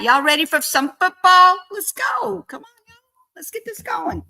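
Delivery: a high, country-flavoured hype-up; yee-haw energy to rally the chat, pitch jumping high; country twang. Acted. Rallying the chat for football, in a high country twang. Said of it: "that sounded so country and lovely".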